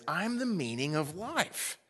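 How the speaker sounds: Jesus telling seekers he is their path, a light voicing. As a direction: speak as Jesus offering the path. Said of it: "What he means by that is".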